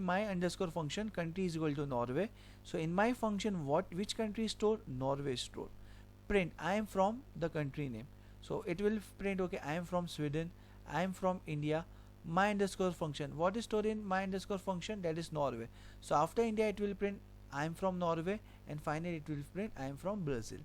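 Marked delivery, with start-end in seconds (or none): none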